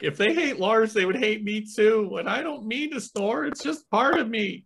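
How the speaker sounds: very high, whiny self-pitying voice; hammed-up wounded protest; mock hurt plea. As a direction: plead your case in a high squeak. Acts own hurt plea over snoring.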